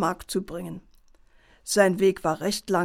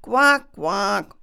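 frog croaks; 6 dB louder than usual. Acted.

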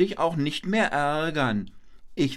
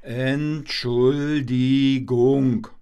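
stretched-out, louder Entschuldigung; slow, clear apology; 4 dB louder, much slower than usual. Shown as a demonstration. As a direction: say Entschuldigung slowly and syllable by syllable. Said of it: "Ich hab nichts verstanden". (langsam und deutlich: Ent-schul-di-gung)

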